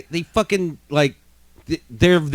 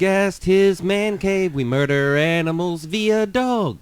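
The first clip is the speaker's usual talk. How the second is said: slow, higher announcer intro; podcast host voice; 7 semitones higher, slower than usual. Pretend.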